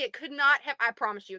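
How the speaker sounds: ordinary delivery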